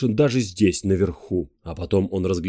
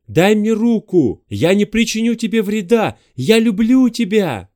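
shouting over wind; 11 semitones higher, 5 dB louder than usual. Acted. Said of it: "стараясь перекричать ветер".

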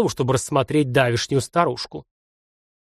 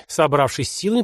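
ordinary delivery

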